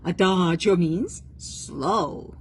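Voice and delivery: slow, stretched-out words; drawn-out slow. Shown as a demonstration. Teacher contrasting adagio with allegro, slow and drawn-out. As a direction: draw it out to show slowness.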